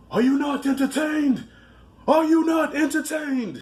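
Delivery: mock gladiator bellow; Gladiator quote. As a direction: hurl it at the crowd like Maximus. Quoting Gladiator line, repeated.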